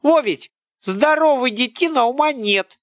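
high, loud village scold; an angry peasant woman tearing into a boy; scolding old woman. A narrator's reading. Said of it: "перенесла тётка огонь". (сварливая тётка, громко и высоко)

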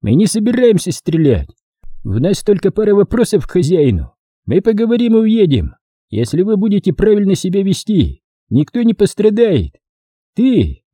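high-pitched commander addressing a crowd; командир отряда. Acted. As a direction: call out reassurance with authority over the din. Figure of the story. (командирский голос, повышенный тон)

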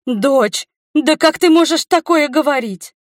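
high, loud, theatrical indignation; feigned gasp of shock. A narrator's reading. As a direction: act scandalised at your daughter. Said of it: "ахнула наигранно матушка".

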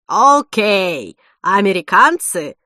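bellowing cook voice; angry chef. A narrator's reading. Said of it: "проревел повар".

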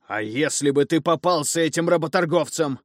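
loud, high-pitched scolding; fearful rage at the boy; angry. Acted. Father keeps scolding.

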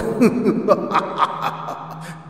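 breathy ghostly laugh; evil laugh between threats; demonic cackle. Acted. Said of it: "tiếng cười".

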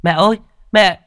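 put-on high, loud boy's cry; yelling child. A narrator's reading. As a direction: shout for mother while running. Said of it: "Vừa chạy vừa la hét".

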